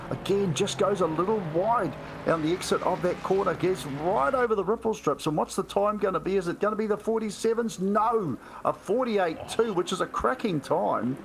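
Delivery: excited play-by-play. Real, not acted. Co-commentator awaiting the lap time at the line, excited. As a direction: pose the suspense, then call the time.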